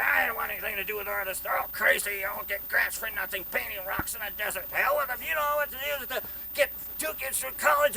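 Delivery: loud, high-pitched cantankerous rant; imitated grouchy tirade at full volume; Ross Ward, mean. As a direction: rail scornfully against the whole lot of them. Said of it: "this guy's so mean".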